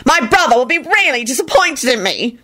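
mild mock shriek of misery; unhappy shriek. A narrator's reading. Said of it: "Dorcas shrieked unhappily".

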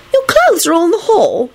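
put-on high, puzzled girl's question; genuinely bewildered. A narrator's reading. Rosie learns of Neville's clothes, high bewilderment. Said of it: "in a genuinely bewildered tone".

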